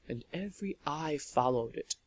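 plain reading voice

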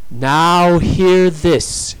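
slow, loud, high proclamation; booming, drawn-out shout; yelling. A narrator's reading. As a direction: yell it out slowly like a decree. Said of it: "she yelled at the vampires".

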